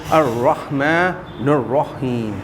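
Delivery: الرحمن الرحیم very slow, heavy r and airy ح; correct Quranic reading. Shown as a demonstration. Teacher shows the difference to the class, slow careful recitation. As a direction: draw out الرحمن الرحیم with full throaty ح. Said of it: "کتنا فرق پڑ جاتا ہے".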